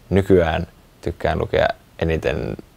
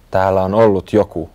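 plain reading voice

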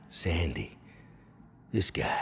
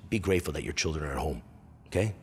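normal talking voice

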